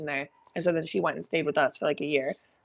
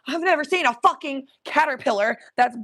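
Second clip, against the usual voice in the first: disgusted; 8 semitones higher, 3 dB louder than usual. Real, not acted. Disgusted at huge caterpillar.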